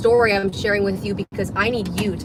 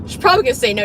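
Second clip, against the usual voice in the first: louder, higher, punching "probably"; emphatic repeat; 4 semitones higher, 6 dB louder, much faster than usual. Shown as a demonstration.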